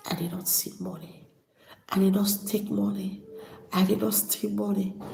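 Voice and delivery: breathy tearful whisper, barely voiced; hushed, trembling on the edge of tears; choked whisper. Real, not acted. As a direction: hold back tears, whispering. Tearful account of father's comfort.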